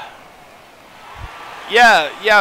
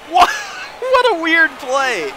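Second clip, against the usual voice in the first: surprised; 11 semitones higher, slower than usual. Real, not acted. Reacting to the game.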